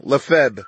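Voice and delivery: "Lefebvre" as luh-FEB, falling; corrected pronunciation. Real, not acted. Narrator not wanting to say it wrong, careful luh-FEB. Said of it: "say it wrong".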